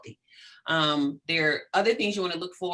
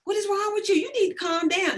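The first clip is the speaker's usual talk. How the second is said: much higher, incredulous scolding; exasperated outburst; 14 semitones higher than usual. Retold or imitated. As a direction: react like you can't believe them. Reacting to loud subway kids.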